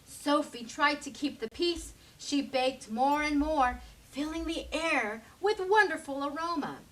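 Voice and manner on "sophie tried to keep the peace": plain reading voice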